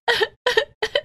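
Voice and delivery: high sob. Acted. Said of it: "जोर-जोर से रोने लगी".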